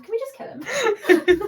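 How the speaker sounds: slow, high, laughing through it. Acted. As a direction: ask it while cracking up. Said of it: "in Sylvan say to Loran".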